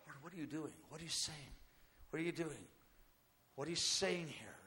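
very soft, low murmured questions; quiet prayer. Acted. Singers on the platform asking the Lord, quietly. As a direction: dial right down and ask under your breath. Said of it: "all the singers are quietly".

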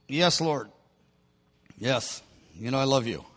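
low, soft, reluctant mumble; mumbling. Acted. Peter reluctantly answering Jesus, sheepish mumbling. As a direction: mumble it sheepishly under your breath.

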